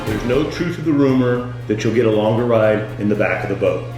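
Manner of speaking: ordinary talk, nothing marked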